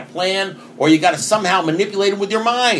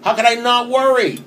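incredulous mock outrage; 7 semitones higher than usual. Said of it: "you're saying".

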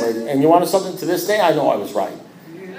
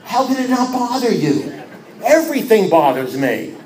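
incredulous; 6 semitones higher than usual. Retold or imitated. Disbelieving reply to sponsor.

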